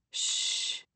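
soft unvoiced hush; shushing. A narrator's reading. Nick hushing the women outside the door, soft shush. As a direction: hush them like a sleeping baby. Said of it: "one finger to his lips".

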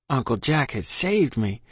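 plain reading voice

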